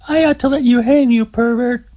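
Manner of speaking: high teasing woman's voice, gloating; enjoy turning the tables on him; rubbing it in. Acted. Terry taunts trapped Scott, high gloating tease. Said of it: "deciding to rub it in a little".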